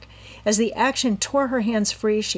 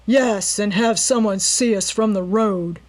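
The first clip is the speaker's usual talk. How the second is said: scornful and louder; sneering; 3 semitones higher, 6 dB louder than usual. A narrator's reading. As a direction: sneer it at him. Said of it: "sneered the leader".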